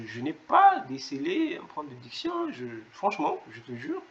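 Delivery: usual speaking voice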